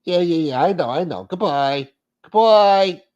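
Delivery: high put-on voice, building to a loud goodbye; mock brush-off. Caller in a skit getting off the phone, mock impatient. Cutting off the acted phone call.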